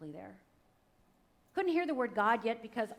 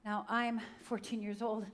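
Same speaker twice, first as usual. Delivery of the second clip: ordinary talk, nothing marked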